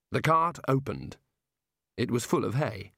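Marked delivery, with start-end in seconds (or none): none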